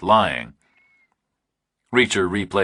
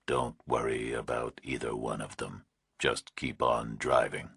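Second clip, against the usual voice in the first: distorted nightmare voice; 7 semitones lower, 9 dB quieter than usual. A narrator's reading. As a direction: breathe it like a monster on the line. Kidnapper's distorted voice.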